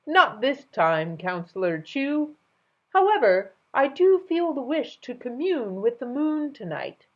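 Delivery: lightly voiced grand king; amused king. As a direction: speak grandly.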